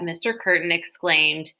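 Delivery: ordinary delivery, nothing marked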